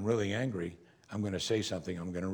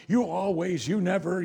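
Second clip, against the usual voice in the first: higher, heated quarrel voice; mock marital quarrel; 8 semitones higher, 3 dB louder than usual. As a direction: imitate a wife mid-argument. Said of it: "using words like always and never".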